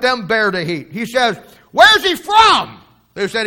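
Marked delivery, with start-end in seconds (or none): 1.7-2.8 much higher, loud and slow, hammered out; shouted demand. Retold or imitated. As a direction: bellow it like Pilate cornered. Pilate demands where Jesus is from.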